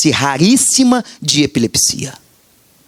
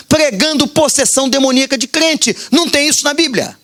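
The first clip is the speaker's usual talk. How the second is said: higher-pitched fervent preaching against false pastors; 6 semitones higher than usual. Acted. Pastor warns church about false pastors, fervent preaching. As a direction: drive the warning home to the congregation.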